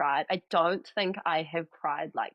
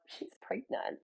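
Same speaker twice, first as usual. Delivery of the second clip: whispered, hushed gossip; gossipy whisper; 6 semitones higher, 10 dB quieter, slower than usual. Retold or imitated. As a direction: lean in and whisper it like juicy news. Friends gossiping, whispered aside.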